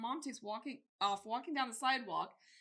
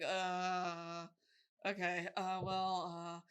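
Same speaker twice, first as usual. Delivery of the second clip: low, halting imitation; hesitant son; 4 semitones lower, much slower than usual. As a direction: stall with drawn uhs, few words. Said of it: "he's not a man of many words".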